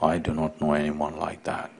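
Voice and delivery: low, soft and grave; a low, grave voice from inside. Acted. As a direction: speak with solemn authority from within. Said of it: "A grave voice from inside said".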